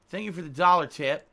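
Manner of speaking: usual speaking voice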